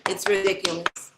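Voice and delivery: ordinary delivery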